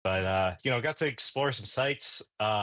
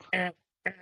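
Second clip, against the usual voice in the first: high vocal imitation of a horn; obnoxious horn honk; 9 semitones higher than usual. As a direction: honk obnoxiously in two short bursts. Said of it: "do the obnoxious thing".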